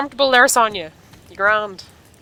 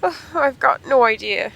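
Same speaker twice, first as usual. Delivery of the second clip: line read as Sonya, a bit higher and slow; slow, lightly raised character read; 7 semitones higher, 6 dB louder, slower than usual. Shown as a demonstration. Reading Sonya's line aloud in a slow, slightly raised character voice. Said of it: "or you've got no idea".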